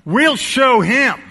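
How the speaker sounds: mock defiant crowd; defiant shout. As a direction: shout it like stubborn prophets. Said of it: "so what do they do?".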